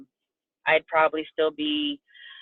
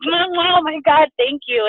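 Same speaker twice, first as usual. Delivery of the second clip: emotional gush; 8 semitones higher, 6 dB louder, slower than usual. Pretend. Puerto Rican hug and kiss gush.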